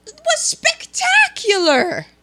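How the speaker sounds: high, slow, swooning delight; gushing praise. Real, not acted. Cohost gushing over the lavish office, swooning. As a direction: rave about it like it's breathtaking. Raving about the movie office.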